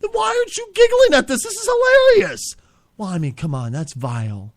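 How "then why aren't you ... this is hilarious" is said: much higher teasing voice; mock incredulity. Co-host teases the host for not laughing, high mocking voice. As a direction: needle him with fake disbelief.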